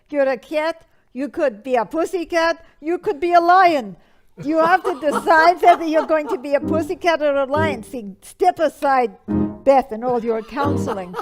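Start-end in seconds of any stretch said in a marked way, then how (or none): 0.1-7.8 emphatic advice. Acted. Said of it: "I would have said".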